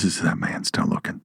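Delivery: sly snake voice. Retold or imitated. The snake checking whether the man fell for it, low and sneaky. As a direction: play the snake peeking to check if he's fooled.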